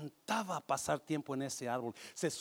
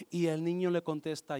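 ordinary talk, nothing marked

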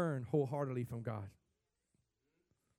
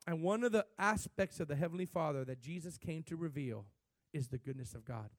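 ordinary delivery

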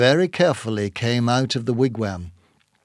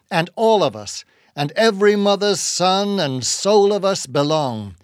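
higher-pitched, earnest oratory; impassioned sermonizing; 5 semitones higher than usual. A narrator's reading. Ishmael hard pushed, improvising a grand sermon, impassioned. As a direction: preach it grandly, building on the phrases.

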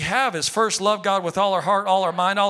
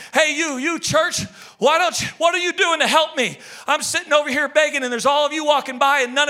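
loud, high, pleading beggar calling out; begging cry to church; 9 semitones higher, 5 dB louder than usual. Acted. A blind beggar calls to the church, pleading shout. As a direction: demand help from the passing church.

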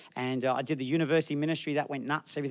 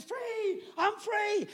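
very high, softer blissful sighing; blissed-out worshipper; 5 dB quieter, much slower than usual. Retold or imitated. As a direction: mimic someone lost in rapture. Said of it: "And there's people like this".

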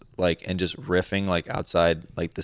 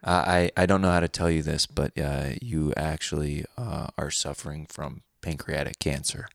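hushed, low, hesitant deadpan; calm radio host; 4 semitones lower, 5 dB quieter than usual. Acted. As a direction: break terrible news very softly and calmly. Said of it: "They're always the calmest about the most crazy shit".

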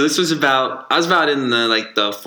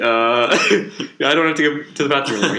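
a nervous chuckle then backing out; awkward laughing excuse; 3 semitones higher than usual. Retold or imitated. Boy at the urinal laughs awkwardly, backing off. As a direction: uncomfortable kid laughing his way out. Other kid excuses himself.

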